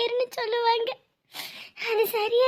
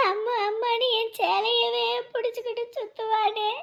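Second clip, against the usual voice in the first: normal talking voice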